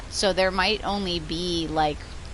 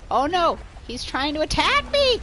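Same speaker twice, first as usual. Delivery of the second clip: high, loud mock panic; panicked elk; 10 semitones higher, 7 dB louder than usual. Streamer voicing the confused elk, high loud mock panic. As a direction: squeal it like a startled elk cartoon. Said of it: "He's like".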